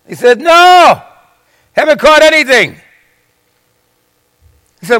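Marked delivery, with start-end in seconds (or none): 0.4-2.8 high, raised voice answering across water; calling back. Acted. Fishermen answer the stranger on the shore, calling back. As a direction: shout back to someone on shore.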